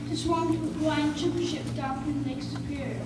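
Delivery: usual speaking voice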